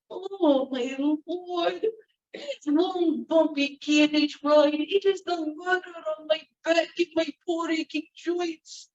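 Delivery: high, slow whining crone; milk the aches for sympathy; complaining old woman. Acted. Old woman whining to the Baron about her back.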